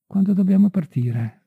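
a touch of eagerness; eager question. A narrator's reading. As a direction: ask promptly, voice lifted. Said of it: "domandò Marie sollecita".

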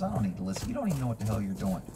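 low, gruff talking horse. Acted. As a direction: speak as a cocky horse. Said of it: "This horse says".

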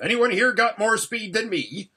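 high, loud appeal to the group; calling out urgently. Acted. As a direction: call for a volunteer.

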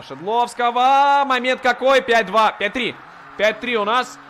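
loud, high goal call; excited goal call. Real, not acted. Goal scored. (взволнованный крик о голе)

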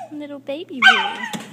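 high squeaky coo. Real, not acted. Cooing to kangaroo.

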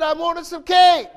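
put-on high, loud kid's excuse; childlike pleading. Son caught at night, answering in a high loud kid voice. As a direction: play the boy owning up. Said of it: "He said".

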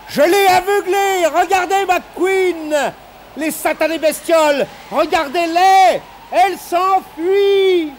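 very high, drawn-out yells; crazed triumphant shouting. A narrator's reading. Reedus tells McQueen the creatures are fleeing, manic triumphant shouts. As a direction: crow wildly like a madman. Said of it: "criait et riait comme un fou".

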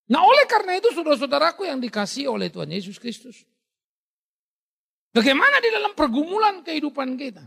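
high, loud rousing call; fiery preaching. Real, not acted. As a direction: lift the whole church with it. (pengkhotbah berseru penuh semangat kepada jemaat)

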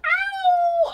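high, loud wolf howl. Shown as a demonstration. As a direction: howl at the full moon. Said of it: "Oh, I'm still howling".

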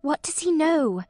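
normal talking voice

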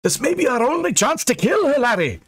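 high-pitched urgent objection; agitated high protest; objecting. A narrator's reading. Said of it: "Angus objected".

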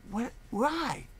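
what, then a why jumping way up high; bewildered, can't take in the sight; a stunned man staring at a mountain of mail; stunned disbelief. Retold or imitated.

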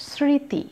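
স্মৃতি with silent m and nasal vowel; slightly nasalised vowel. Shown as a demonstration. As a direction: say স্মৃতি as sriti, lightly nasalised. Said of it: "এখানেও একই ব্যাপার".

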